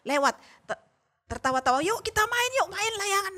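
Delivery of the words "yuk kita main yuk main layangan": high playful call; children outside calling to fly kites, higher; playful friend. Retold or imitated. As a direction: invite friends out to play. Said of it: "ada suara temannya bermain".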